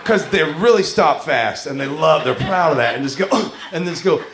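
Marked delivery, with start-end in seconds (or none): none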